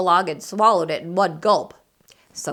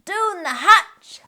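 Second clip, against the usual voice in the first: high, slow, laughing cheer; much higher crew yell with a laugh; crew shout; 11 semitones higher, 3 dB louder, slower than usual. Acted. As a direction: roar it back like a rowdy crew. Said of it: "we all shouted".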